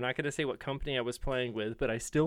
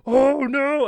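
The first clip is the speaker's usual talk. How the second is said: high, loud panicked cry; panicked yell; 12 semitones higher, 13 dB louder than usual. Retold or imitated. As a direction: yelp it in sudden panic. Panic hearing the overture.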